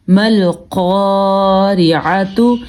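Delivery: slow مل قارعۃ ending in "tu"; long held قا, final ۃ sounded as تو; joined ending. Shown as a demonstration. Joined form before the pausing form. (مل قارعۃ، آخر میں تو پڑھ کر)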